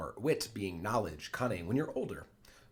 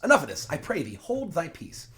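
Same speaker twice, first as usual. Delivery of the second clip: louder, higher exasperated interruption; fed up; 7 semitones higher, 7 dB louder than usual. Acted. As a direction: cut her off as a fed-up lady of the house. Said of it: "kind of fed up with this".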